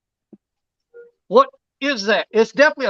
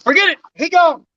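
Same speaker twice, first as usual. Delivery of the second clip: exasperated; 7 semitones higher, 4 dB louder than usual. Real, not acted. Fish escapes, exclaim.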